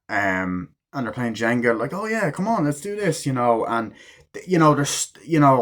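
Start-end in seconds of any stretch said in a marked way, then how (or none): 1.9-3.2 high-pitched mock party cheer; acted eagerness. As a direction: egg friends on, pitched up. Voices friends keen to play Jenga.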